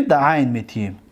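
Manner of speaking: normal talking voice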